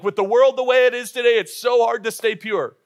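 mock the ancient people's protest loudly. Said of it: "they're like".

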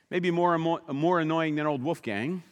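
ordinary talk, nothing marked

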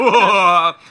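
very loud, high whooping cackle; put-on signature laugh. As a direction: blast out the fake laugh. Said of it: "I love this laugh".

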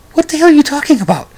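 high, fast, baffled disbelief; what on earth are you saying; incredulous. Retold or imitated. Host voicing his baffled look at the grandmother, high and fast.

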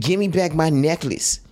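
put-on street talk. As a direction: ham up a movie-style demand. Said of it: "You don't even talk like that".

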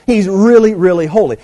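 loud stress on REALLY, REALLY; emphatic repetition. Shown as a demonstration. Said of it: "they say that for emphasis point".

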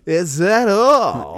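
high, slow, exaggerated cheerful question; drive thru speaker. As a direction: drag it out like a bubbly clerk. Imitating drive-thru worker.

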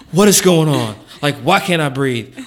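frantic inner voice, half-acted; panicked self-talk. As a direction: replay the moment the panic hit, loud and rushed. Retold panic, searching his symptoms.

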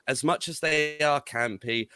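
ordinary talk, nothing marked

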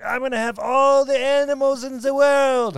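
high, loud, drawn-out proclamation; put-on emperor voice. Joking about the emperor's grand plan, put-on high voice. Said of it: "I don't know why I went".

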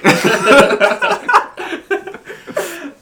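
high, loud cackling laugh; loud laughing fit. Real, not acted. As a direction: burst out laughing at your own joke. Cracking up at own joke.

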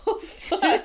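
laughing oh fuck; laughing swear. Real, not acted. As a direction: swear through a burst of laughter. Laughing at horse story.

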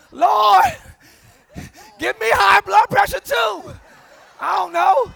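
loud, high laughing plea to God; hammed-up desperate prayer; mock exasperated prayer. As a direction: cry out to the Lord, half laughing. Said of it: "I'm just sitting there like".

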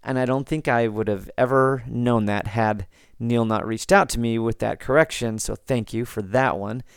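normal talking voice

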